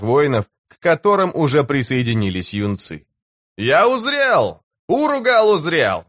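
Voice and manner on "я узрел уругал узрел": high, drawn-out proclamation; triumphant war cry. A narrator's reading. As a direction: cry it out to your god, stretching the words. Warrior cries out to his god. (торжествующий протяжный клич)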